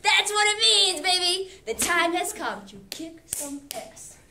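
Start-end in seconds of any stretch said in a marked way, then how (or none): none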